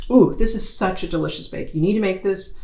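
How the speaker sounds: usual speaking voice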